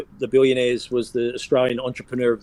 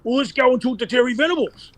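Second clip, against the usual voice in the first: high-pitched accent impression; Con Constantine accent; 11 semitones higher than usual. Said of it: "I'll do the Con accent".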